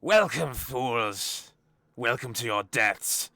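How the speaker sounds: loud, booming menacing proclamation; screaming villain voice. Acted. Game master as trap voice greeting the party, screamed out. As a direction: cry it out slowly, gloating over doomed intruders. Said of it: "a voice screams out".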